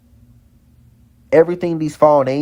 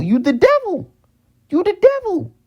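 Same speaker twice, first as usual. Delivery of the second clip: each repeat pitched far higher, building; rising accusation. Real, not acted. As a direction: hurl it back harder every time. Accusing the devil, repeating louder.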